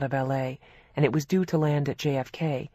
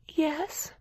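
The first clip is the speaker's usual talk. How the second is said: sadly; 12 semitones higher, 5 dB quieter than usual. A narrator's reading. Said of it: "Gracie said sadly".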